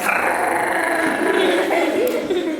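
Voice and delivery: high imitated dog freak-out; drawn-out comic animal noise; startled dog noise. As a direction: imitate a dog frantic at its tail. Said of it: "and they're like".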